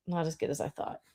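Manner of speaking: muttered repeat. Shown as a demonstration. Echo it quietly under your breath.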